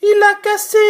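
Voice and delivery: very high, fast squeaky little bear; tiny bear's voice. Acted. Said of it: "de sa toute petite voix".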